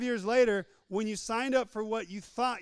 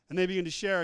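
usual speaking voice

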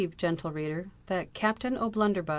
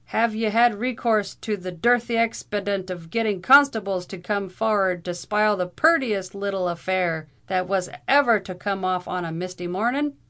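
loud, higher Irish brogue; Irish brogue rant; 3 semitones higher, 7 dB louder than usual. A narrator's reading. Irish captain rails against the constables spoiling his duel. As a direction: rail at them in a thick brogue.

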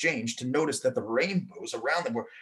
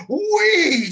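high, loud gleeful squeal; excited child whee; 9 dB louder than usual. A narrator's reading. Girl shouting with joy while falling. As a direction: let it fly like a kid on a slide. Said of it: "Samantha exclaimed".

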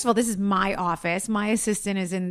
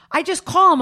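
usual speaking voice

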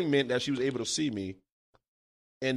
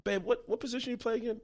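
imitating mom; 9 semitones higher, 4 dB quieter than usual. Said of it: "she was like".

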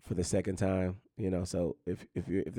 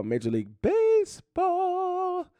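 announcer call; 4 dB louder, slower than usual. Acted. Announcing next sports segment.

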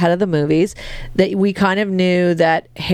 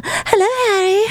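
put-on very high, whiny ghost girl. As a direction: croon it like a lovesick ghost.

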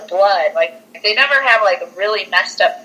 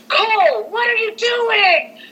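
very high, drawn-out mock scream; mock screaming mother; slower than usual. Mom finding her son in a wrecked kitchen, high mock scream. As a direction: imitate a mom shrieking in alarm, without full volume. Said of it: "she's, like, kinda screaming at him".

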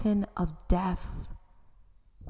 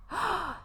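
gasp; 11 semitones higher, 4 dB quieter than usual. Acted. Lady startled at newcomer.

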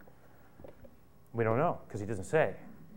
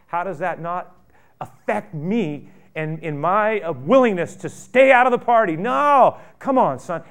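loud, high pleading cry; preaching at full force; 5 semitones higher, 10 dB louder than usual. Acted. Pastor pleading with the resentful son, in a loud high preach. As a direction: rise up and plead at the top of your voice.

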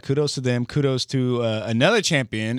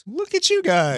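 high mock voice; 13 semitones higher than usual. Teasing mock voice.

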